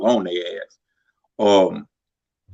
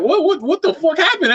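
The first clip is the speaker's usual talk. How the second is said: much higher, eager mock outburst; excited disbelief. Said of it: "I'm all excited".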